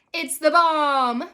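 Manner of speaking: slow, loud gloating cry; drawn-out loud gloating; gleeful cackle. A narrator's reading. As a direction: crow it with mischievous glee. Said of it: "Mason cackled".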